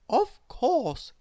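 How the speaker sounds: helpful and kind; librarian. Acted. Missus Page answers Miss Curious politely. Character voice.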